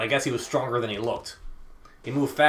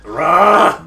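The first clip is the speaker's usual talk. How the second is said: loud, raised beastly roar; animal roar; 6 semitones higher, 13 dB louder than usual. Acted. Furious man lunges for the rope, animal roar. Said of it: "It was like he was an animal".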